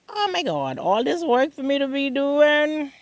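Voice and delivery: high dismayed exclaim; overwhelmed at all the work. Retold or imitated. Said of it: "I look at myself and be like".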